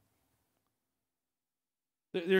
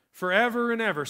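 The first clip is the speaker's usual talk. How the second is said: normal talking voice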